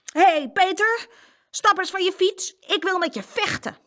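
put-on high, loud little-boy challenge; small boy acting tough; bold call. A narrator's reading. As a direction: call out bravely, picking a fight. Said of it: "riep heel flink".

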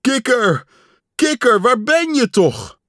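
high, loud, worried cries; desperate calling. A narrator's reading. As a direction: call frantically for a lost friend. Pat desperately searches for Frog.

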